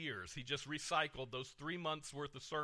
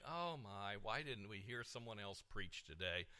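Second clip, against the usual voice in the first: mock grumbling; 8 semitones lower, 10 dB quieter than usual. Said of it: "would leave grumbling".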